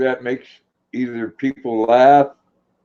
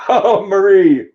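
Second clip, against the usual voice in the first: laughing; 6 semitones higher, 5 dB louder, slower than usual. Real, not acted. Laughing at a funny remark.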